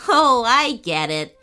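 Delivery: loud, amused dawning realisation; chuckling realisation. Acted. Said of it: "chuckled Little Bro".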